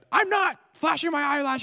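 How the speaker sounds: high, loud shouted denial; indignant mom shout. Retold or imitated. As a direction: shout it back high and offended, as the caught-out mom. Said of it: "And she would be like".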